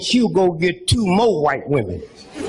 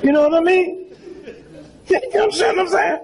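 laughing; 11 semitones higher, faster than usual. Real, not acted. Laughing at crowd.

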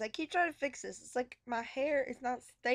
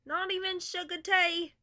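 put-on British accent; 5 semitones higher, 4 dB louder than usual.

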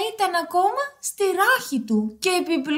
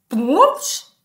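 sudden loud spoken splash; splash noise; 7 dB louder than usual. Acted. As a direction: pop out the sound of a plunge. Imitated splash of the dive. (ήχος βουτιάς στο νερό)

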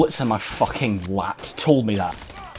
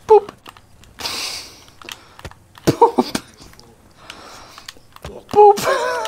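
high squeaky boop; much slower than usual. Real, not acted. Playful sound while gaming.

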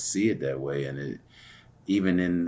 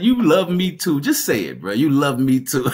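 much higher, louder, laughing through it; laughing tease; 9 semitones higher, 10 dB louder than usual. Retold or imitated. To the man who wanted to fight, laughing and loud. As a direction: tease him while cracking up.

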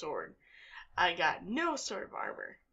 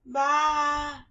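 loud imitated sheep bleat; 6 semitones higher, 7 dB louder than usual.

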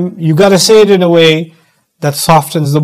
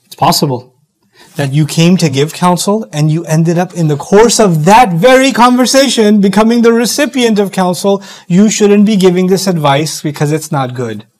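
usual speaking voice

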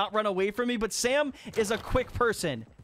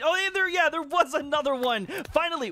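excited spotting; 7 semitones higher than usual. Real, not acted. Spots hidden player, excited.

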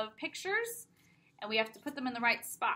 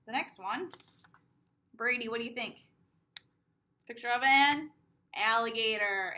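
ordinary talk, nothing marked

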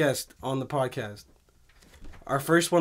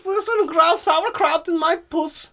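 mock German accent; 13 semitones higher, 3 dB louder than usual.

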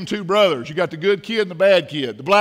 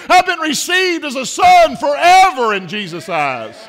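loud pulpit dialogue, building higher; impassioned preaching; 6 semitones higher, 9 dB louder than usual. Real, not acted. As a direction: preach the challenge and the defiant answer.

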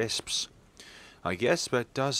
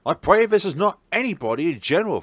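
anxious plea; 7 semitones higher, 9 dB louder, faster than usual. Acted.

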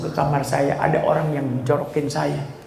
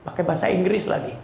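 ordinary delivery

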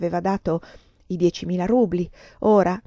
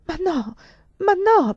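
high-pitched, shocked denial; aghast stammer; 11 semitones higher, 4 dB louder, slower than usual. A narrator's reading. Countess stunned by the lover's accusations, aghast stammer. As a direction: protest haltingly, rising in alarm. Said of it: "balbettai esterrefatta".

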